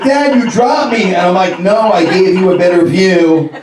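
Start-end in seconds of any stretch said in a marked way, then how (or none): 0.0-1.1 high, loud imitated child; protesting son. Son to the dad who flipped him, high loud protest. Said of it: "He's like". 1.6-3.5 loud, higher mock justification; defensive dad. Dad to the son he dropped, loudly defensive.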